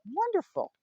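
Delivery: very high, loud cheer; bright-pitched, punchy retold delight. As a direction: burst out with pleased relief. Retold delighted reply to doctor.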